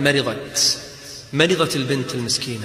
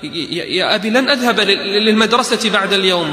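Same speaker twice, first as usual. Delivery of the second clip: higher, louder pleading, stammering; pleading daughter; 6 semitones higher, 3 dB louder than usual. Retold or imitated. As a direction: stand firm though frightened. Daughter defies father tearfully. (البنت تتحدى أباها متوسلة)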